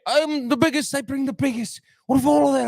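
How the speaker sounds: high-pitched mock freak-out; Conor McGregor spazzing out. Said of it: "he spazzed out on".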